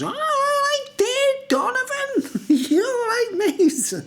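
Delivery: very high, drawn-out squeaky greeting; high laughing squeaky mock voice; mocking weird guy. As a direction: squeal the name like a pest. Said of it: "And he goes".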